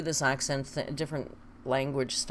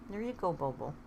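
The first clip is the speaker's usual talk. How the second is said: ordinary delivery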